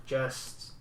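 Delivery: low, hushed whisper in character; whispered aside. Acted. Fianna privately reassuring travellers about the distance, whispered.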